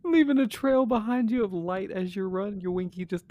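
laughing. Real, not acted. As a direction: giggle through the words. Joking about running from Sasquatch.

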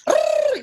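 long, high-pitched trilled R; exaggerated Spanish tongue trill; rolled Mexican R. Shown as a demonstration. Actor jokingly proving she can sound Mexican, high rolled R. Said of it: "sound like I'm Mexican unless I'm gonna go".

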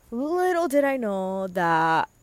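drawn-out storyteller suspense; slow, stretch it out teasingly; mock-dramatic foreshadowing. Real, not acted.

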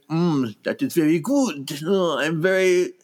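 slow, deliberately bad French accent; a hammy French chef. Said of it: "badly French".

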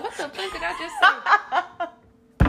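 slow, high and laughing disbelief; mock your own confusion while cracking up; laughing self-mock. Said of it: "you ever say something and be like".

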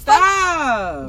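high, loud drawn-out cry to stop; yelled stop. Real, not acted. Partner in a play fight yelling to stop. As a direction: yell it high and long.

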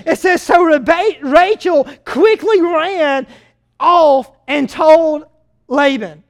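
usual speaking voice

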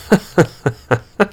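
high bleating cackle; bleating laugh. A narrator's reading. Gnome laughing at the princess's naive remark, bleating. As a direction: let out a goat-like mocking laugh. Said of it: "Opal lachte meckernd".

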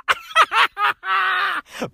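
high-pitched laughing burst; gleeful high laugh; real laughter. Real, not acted. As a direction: crack up after your own singing. Laughs after singing the song.

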